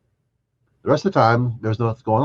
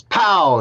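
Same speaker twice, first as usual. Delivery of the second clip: loud, much higher pow; imitated pop; 10 semitones higher, 3 dB louder than usual. Chiropractor mimicking the adjustment, loud pow. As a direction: snap out the crack sound.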